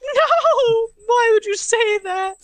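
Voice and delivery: high, loud squeaky wail; mock crying. Squeaky character reacts to being talked about, mock crying. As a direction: wail it like a wounded cartoon mouse. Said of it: "I don't know why you're crying".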